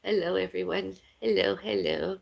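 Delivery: ordinary talk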